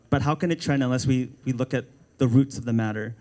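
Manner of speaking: usual speaking voice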